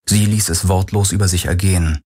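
plain reading voice

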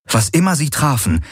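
ordinary delivery

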